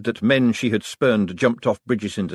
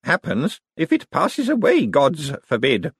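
put-on old lady voice; 7 semitones higher than usual. A narrator's reading. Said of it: "said an elderly voice".

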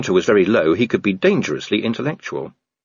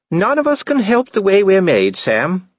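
higher, gently reproving lady's voice; Lady Sybil; 11 semitones higher, 4 dB louder than usual. A narrator's reading. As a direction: scold him kindly.

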